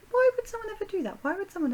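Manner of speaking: high, loud and fast; indignant outburst. Real, not acted. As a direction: cry out in outrage.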